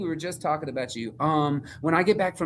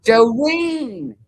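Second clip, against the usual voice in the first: excited calling; 10 semitones higher, 8 dB louder than usual. Real, not acted. Calling dog on video call.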